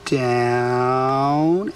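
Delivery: down held long on a rising tune; elongated down. Shown as a demonstration. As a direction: draw down out long. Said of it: "you say the command down".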